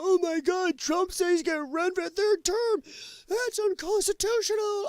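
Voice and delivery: high, mock-outraged critic voice; mocking outraged Democrat. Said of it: "I've seen them already going".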